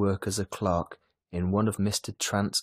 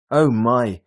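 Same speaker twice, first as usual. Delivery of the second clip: sigh; 4 semitones higher, 10 dB louder than usual. A narrator's reading. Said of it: "Missus Dale sighed".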